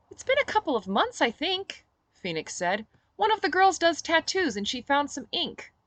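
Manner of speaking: lightly voiced Phoenix. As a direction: shrug it off lightly. Phoenix explaining tattoo.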